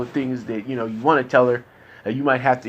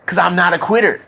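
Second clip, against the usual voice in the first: defiant; 4 semitones higher, 6 dB louder than usual. Real, not acted. Insisting he is no quitter.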